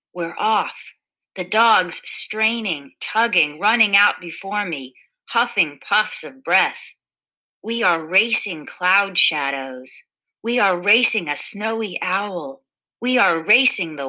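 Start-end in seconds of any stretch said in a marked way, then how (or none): none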